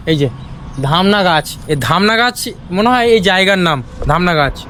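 ordinary talk

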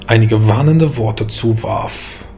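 ordinary delivery

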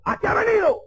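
high mock-angry shout; acted interrogating bellow; fierce father. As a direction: demand an answer as the irate father. Fierce father demands reason for visit.

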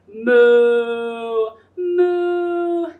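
playful mooing; cow moo. Pretend. Cow sound for kids.

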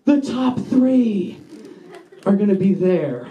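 high, drawn-out realization, sinking at the end; slow mock horror, dropping away; dawning dread. Kid realizing his files will be shown, dawning dread. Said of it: "it just fucking hits me".

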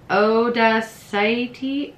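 very slow "Odacite" as oh-dah-site-ee with a rise; second go at "Odacite", dragged out slowly and rising; trying the name again. Shown as a demonstration. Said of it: "sorry if I butcher this".

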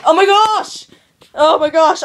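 a loud, startled outburst at the near accident; startled exclaim. Real, not acted. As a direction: blurt it out, startled.

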